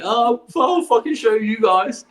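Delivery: put-on a stubborn teen getting the last word; defiant retort. Answering his parents in the argument, slow mock defiance.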